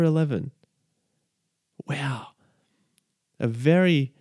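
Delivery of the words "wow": breathy whispered wow; hushed wow. Real, not acted. Speaker marking nine eleven, a whispered wow. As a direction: let the wow fall to a whisper.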